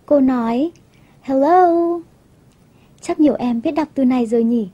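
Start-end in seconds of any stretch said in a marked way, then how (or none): 1.2-2.0 "Hello" as a lightly surprised greeting, pitched up; surprised princess. Acted. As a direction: greet as if startled by someone calling. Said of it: "công chúa ngạc nhiên quá và quay sang chào".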